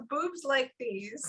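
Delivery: mock-confiding, stretched out; imitated reply. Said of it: "She goes".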